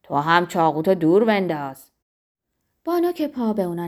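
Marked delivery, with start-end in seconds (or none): 0.0-1.9 loud, low-pitched command; shouted demand. A narrator's reading. Zorba demanding his rival disarm, shouted demand. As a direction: call it out forcefully, kept low. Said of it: "آنگاه فریاد کشید".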